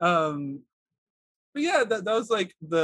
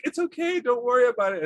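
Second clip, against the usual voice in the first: high mock calm dad; lightly voiced soothing father; reassuring dad; 7 semitones higher, 3 dB louder than usual. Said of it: "he's like".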